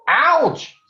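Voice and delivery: sudden high yelp of pain. Acted. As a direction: yelp as if pinched. Doctor pinches Papa's waist.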